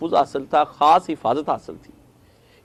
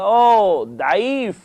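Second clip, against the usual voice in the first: louder, higher "او، ضعیف" with a hard d; 6 semitones higher, 8 dB louder, much slower than usual. Retold or imitated. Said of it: "وہ کہنے لگا".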